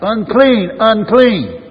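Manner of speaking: a high, very loud warning cry; a desperate outcast's high wail to the crowd; leper crying out. Acted. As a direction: shout it high so everyone keeps away. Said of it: "he had to cry out".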